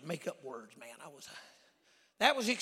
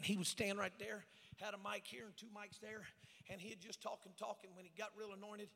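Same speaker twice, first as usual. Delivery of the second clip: very soft, off-the-mic murmur; imitating pastor's talk; 14 dB quieter than usual. Preacher showing how his pastor talked, hushed imitation. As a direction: talk on very quietly, as if away from the mic. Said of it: "He talked like this".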